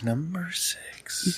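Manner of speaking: slow whispered echo. Pretend. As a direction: breathe it out in a whisper.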